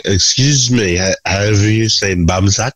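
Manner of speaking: put-on slow, politely lost drunk voice; tipsy tourist. Drunk Westerner asking locals.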